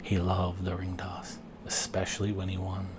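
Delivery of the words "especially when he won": usual speaking voice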